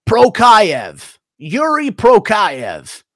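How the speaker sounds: high, loud, slow mock name call; Chael's mispronunciation. Streamer mocking Chael's name-butchering, high and drawn out. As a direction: mangle the name like a punch-drunk pundit. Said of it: "Chael pronounces Pereira Piera".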